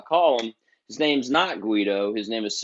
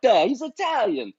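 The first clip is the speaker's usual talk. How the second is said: high-pitched mock Italian accent; Italian manager's accent; 10 semitones higher than usual. Italian manager insisting the musician is Italian, in an Italian accent. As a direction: imitate an Italian insisting on his pronunciation. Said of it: "speaks okay English".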